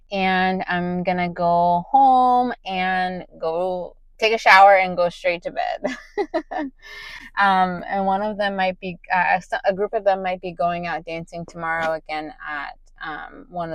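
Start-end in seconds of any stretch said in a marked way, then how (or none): 6.0-7.3 bright high laugh; high giggle. Real, not acted. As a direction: giggle at yourself. Laughing at own bedtime plan.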